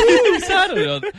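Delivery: very high, drawn-out mimicry; mocking echo. Retelling the teasing over Saturday, high mocking echo. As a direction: mimic it back high and slow, laughing. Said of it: "And I was like".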